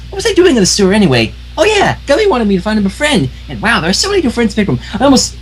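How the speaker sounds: high, bouncy cartoon pony voice; high, giddy pony chatter; bubbly Pinkie Pie. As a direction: chirp it like an overjoyed pink pony.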